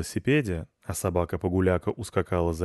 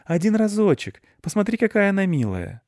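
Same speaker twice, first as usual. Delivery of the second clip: put-on very high, wheedling child voice; pleading boy; 12 semitones higher, 5 dB louder than usual. A narrator's reading. Boy coaxing mother about the cute dog, pleading. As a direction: beg like a little boy.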